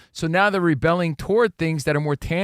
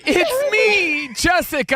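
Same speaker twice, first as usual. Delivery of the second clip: high-pitched mocking quote; 3 dB louder, much slower than usual. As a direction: squeak it out teasingly. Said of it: "Rich said".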